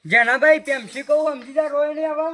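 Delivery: sudden loud appeal; exasperated pleading. Acted. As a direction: raise your voice to get through. Pleading with the crying boy. (અકળાઈને મોટેથી)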